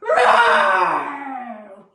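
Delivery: loud roaring shout breaking into a laugh; roar, then laugh at yourself; big lion roar with a laugh; lion's roar. Shown as a demonstration. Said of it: "our last lion's roar now".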